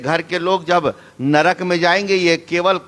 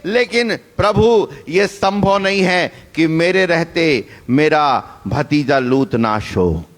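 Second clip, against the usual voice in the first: ordinary talk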